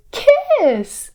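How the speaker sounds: very high, loud squealed word; exclaimed climax. Shown as a demonstration. Storyteller having children finish the line, high exclamation. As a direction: sing out the big reveal up high.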